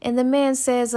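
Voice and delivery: ordinary delivery, nothing marked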